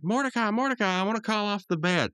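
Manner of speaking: high-pitched imitated appeal; mock urgent call. Rigby running inside to Mordecai, high urgent voice. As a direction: blurt it as a frantic cartoon friend. Acting Rigby rushing in to friend.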